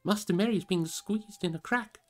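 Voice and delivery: mild urgent cry. Acted. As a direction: blurt it out in a hurry. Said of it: "cried Sam".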